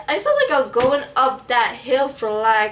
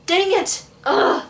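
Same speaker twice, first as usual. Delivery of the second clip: mock frustration; 6 semitones higher, slower than usual. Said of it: "so we're like".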